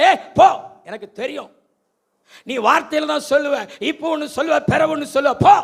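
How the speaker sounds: loud, high dismissive shout; wife scornfully shouting at her husband; scornful retort. Acted. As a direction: throw him off with contempt. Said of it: "Hannah retorting to her husband".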